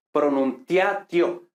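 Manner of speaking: "pronunciatio" with Spanish diphthongs, tia and tio as single syllables; Spanish-style reading. Shown as a demonstration. Teacher showing the reading to avoid, Spanish-style reading. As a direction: read "pronunciatio" as if Spanish, ending pro-nun-tia-tio. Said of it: "leyéndolo así españolizado".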